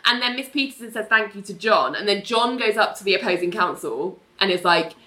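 ordinary talk, nothing marked

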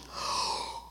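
sharp unvoiced gasp of wonder; imitated gasp. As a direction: gasp in amazement. Father feels baby move.

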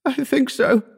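very high, sobbing voice. A narrator's reading. Injured Wells answering Howard, high-pitched sobbing. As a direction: answer through acted sobs, pitched far up. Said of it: "Wells sobbed".

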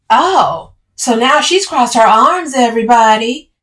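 loud, high singled-out mockery; taunting. A narrator's reading. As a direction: taunt as if announcing to an audience. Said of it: "Camille taunted".

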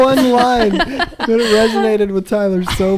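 high, laugh-filled delivery. Real, not acted. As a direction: crack up while explaining. Still laughing at the synopsis.

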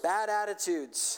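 slow, lifted prayer line for repeating; slow led prayer. Shown as a demonstration. Pastor leading the congregation in a slow call-and-repeat prayer. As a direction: pray it slowly so they can echo. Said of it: "just repeat after me".

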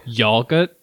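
yogurt said the British way, "yol-gut" with no r; British accent on yogurt, dropping the final t. Retold or imitated. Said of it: "But they say".

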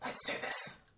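breath-only conspiratorial whisper; whisper in the ear. Acted. Stranger brushing by in the dark, urgent whisper. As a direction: whisper it close, without voice. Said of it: "and whisper in your ear".

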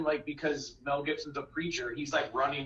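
ordinary delivery

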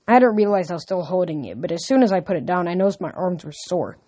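ordinary delivery

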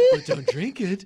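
high, laughing ad parody; mock ad voice. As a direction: lightly send up the commercial. Mimicking the shampoo ad just played.

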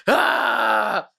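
high little mock scream; mock startled yelp. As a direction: yelp in fake fright. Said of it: "starts fucking screaming".

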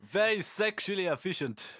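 hammed-up German voice; German accent. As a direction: play up a mock-German efficiency. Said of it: "German attitude".

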